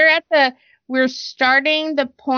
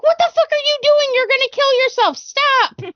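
alarmed yell. Retold or imitated. Mom yelling at her daughter who is in danger, alarmed yell. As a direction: snap at her to stop right now.

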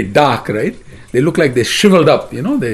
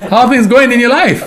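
high, laughing question; playful acted greeting with a laugh; amused greeting; 6 semitones higher, 4 dB louder than usual. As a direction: hail an old friend teasingly. Acting Krishna's teasing greeting.

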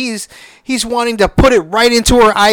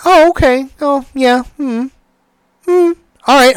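higher-pitched, noncommittal little acknowledgements; imitated unimpressed listener; 6 semitones higher than usual. Partner hearing about the game's ending, imitated polite disinterest. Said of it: "she's just like".

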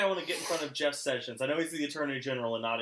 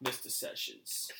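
whispered, much softer hunter voice; Elmer Fudd; 7 dB quieter, slower than usual. Retold or imitated. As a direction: whisper the name like stalking prey. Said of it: "Elmer Fudd".

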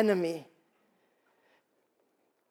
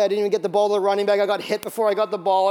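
normal talking voice

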